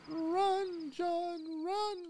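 high, hammed-up cry to flee; mock panicked call. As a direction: yelp it like a movie hero fleeing. Mock panic as night falls.

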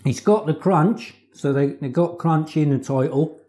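speaking between bites; talking while chewing. Real, not acted. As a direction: mouth partly full of food. Seen 0: Bites fillet piece at 3.4–8.0, then talks while holding it.

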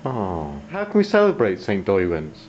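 ordinary delivery

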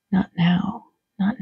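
hushed, half-whispered refusal; soft hush. Acted. Jesus gently refusing Miriam, hushed whisper. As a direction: breathe the words out, barely voiced.

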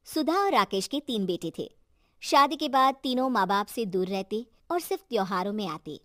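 ordinary delivery

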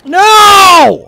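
long, high, loud cry of "no"; drawn-out yell of dismay. Real, not acted. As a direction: shout it out in anguish. Gets stuck and dies.

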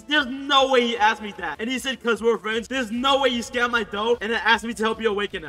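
loud, high-pitched disbelief; incredulous outrage. Real, not acted. Gamer reacts to scammer asking for help, loud disbelief. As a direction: protest as if it's absurd.

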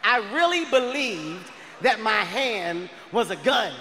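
ordinary talk

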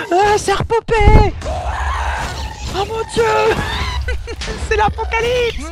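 high shrill panic, half laughing; panicked squeal. Real, not acted. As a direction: squeal it like the world is ending. Mobs respawn, panicked squeal.

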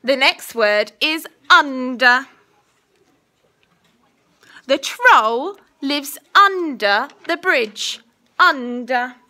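slow, clear dictation voice; school dictation. Acted. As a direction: say each word carefully for spelling. Teacher dictating a spelling test.

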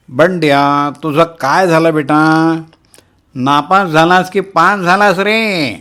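high, slow, nasty neighbour's needling; an old neighbour's shrill, mocking inquiry; shrill ugly voice. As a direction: drag out the question in a grating high voice. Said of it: "आपल्या किरट्या ओंगळवाण्या आवाजात".

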